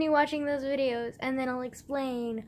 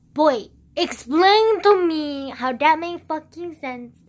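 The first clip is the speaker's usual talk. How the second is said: loud, slow, drawn-out demand; exasperated shout; 4 semitones higher, 12 dB louder, slower than usual. Real, not acted. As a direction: shout it at him in disbelief.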